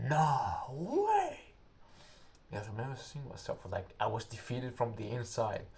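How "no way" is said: disbelief. Retold or imitated. Recruit despairing at more miles.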